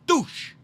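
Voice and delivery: high, sudden "doosh" impact noise; imitated big thud. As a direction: mimic a body hitting the wall. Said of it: "like a big thud sound".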